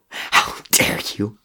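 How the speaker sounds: How dare you! forced out slow and breathless, almost a sob; strangled rage, barely voiced; choked fury. Crow to Murdoch, strangled slow fury. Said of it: "he shouted".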